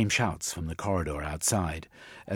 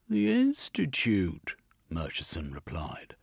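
sarcastic inspector; 2 semitones higher than usual. Acted. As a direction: sneer every word of fake respect. Inspector mocking the Institute.

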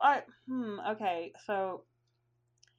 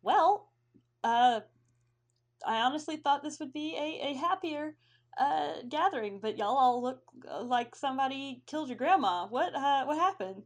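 higher-pitched dragonborn host, puzzled; puzzled host feeling out the mood, pitched up; hesitant, high-voiced dragonborn asking gently; 4 semitones higher, 4 dB louder than usual. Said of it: "he looks around and he goes".

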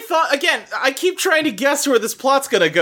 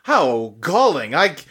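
much lower, haughty pearl-clutching voice; snooty onlooker; 4 semitones lower than usual. Retold or imitated. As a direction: drop low and sound appalled and prim. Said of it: "the reactions are like".

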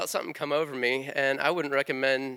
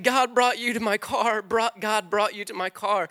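raised, louder voice echoing her cry; quoting her through tears, pitched up; retold tearful cry; 6 semitones higher, 5 dB louder than usual. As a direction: give it her amazed tearful voice, higher and louder. Quotes the weeping driver.

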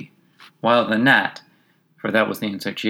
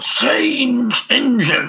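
very high, drawn-out hoarse neigh; hoarse horse voice; 5 dB louder, much slower than usual. A narrator's reading. Horse in the carriage choking out words, hoarse whinny. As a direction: gasp and whinny the words, stretched right out. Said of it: "but a hoarse voice spoke next".

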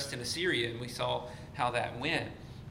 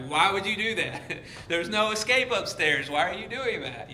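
higher, mock-exasperated protest; exasperated viewer; 6 semitones higher, 4 dB louder than usual. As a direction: scold the movie characters in disbelief. Acted exasperated horror-movie viewer.

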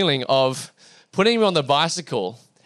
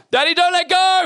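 high, loud imitation of a little boy; hammed-up child's shout of panic; panicked child; 11 semitones higher, 5 dB louder than usual. Son pleading with dad before the push, high mock panic.

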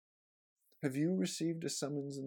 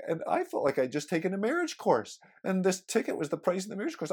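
normal talking voice

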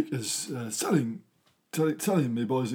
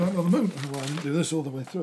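ordinary delivery

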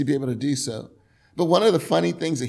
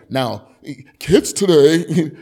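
a hint of a grumpy old man, slow and loud; lightly voiced old codger, drawn out; 9 dB louder, much slower than usual. Shown as a demonstration. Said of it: "I don't know what an old man sounds like".

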